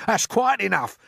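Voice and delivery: high, loud protest; sharp. A narrator's reading. Earthworm refusing to be seagull bait, sharply. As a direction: put a stop to it sharply. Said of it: "the earthworm said sharply".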